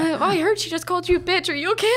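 high, mock-sympathetic coworker voice; fake-concerned coworker. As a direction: put on a nosy sweet coworker. Coworker voiced at cactus club.

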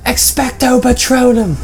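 high, slow dramatic spell cry; spell casting shout. Pretend. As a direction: call out the spell like a wizard. Casting a Harry Potter spell.

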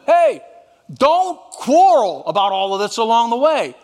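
loud, high parting call; calling after. Acted. Joseph calling after his departing brothers, loud. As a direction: shout after them as they leave. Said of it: "he called after them".

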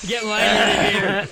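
strained raspy voice as if out of breath; raspy voice after sucking helium; raspy whisper. Acted. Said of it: "I have no voice left".

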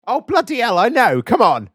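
high, loud, amused exasperation; exasperated protest. Real, not acted. Host to co-host who missed the sarcasm, exasperated. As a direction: protest loudly at being taken literally.

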